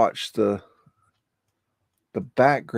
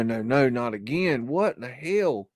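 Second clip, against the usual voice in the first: usual speaking voice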